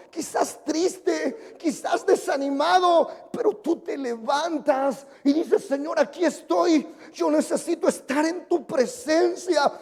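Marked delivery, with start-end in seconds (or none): 5.7-9.7 loud, drawn-out revival preaching that builds to a roar; a pastor thundering at the congregation; preaching at full force. Acted. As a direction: preach it to the back row, stretching every word.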